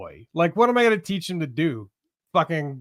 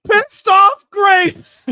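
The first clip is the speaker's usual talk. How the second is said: much higher, much louder, slow; hammed-up yell; 11 dB louder, much slower than usual. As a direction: stretch it out in a cartoon rant. Joining the cartoon dad bit.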